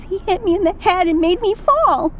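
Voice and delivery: put-on high, wailing little girl; tearful little girl wailing; wailing child. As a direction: cry it out like an upset small child. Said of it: "She wails and cries".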